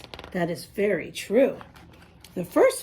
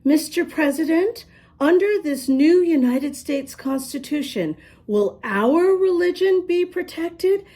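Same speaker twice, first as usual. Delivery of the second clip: higher, lightly voiced lady's question; the lady asks the President a hopeful question, voice raised higher; nice lady asking; 8 semitones higher than usual. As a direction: ask politely as the lady from the synagogue.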